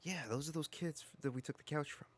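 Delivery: whispering. Acted. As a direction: whisper so nobody hears. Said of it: "We don't have to whisper this".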